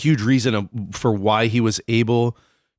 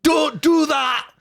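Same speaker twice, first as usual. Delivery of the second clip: much higher, hammed-up Scottish yell; Scottish shout; slower than usual. Podcaster yelling a random Braveheart line, high mock Scottish shout. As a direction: shout it like a mock Highland warrior, pitch way up. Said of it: "a really good thing that you can say in a Scottish".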